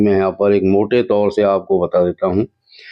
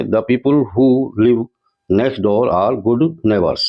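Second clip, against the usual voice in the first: slow dictation read; much slower than usual. Shown as a demonstration. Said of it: "reading the answer sentence".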